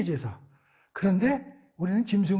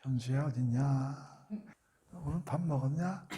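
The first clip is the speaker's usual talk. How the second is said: gentle parent; 4 semitones lower, 7 dB quieter than usual. Acted. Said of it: "야단을 치더라도".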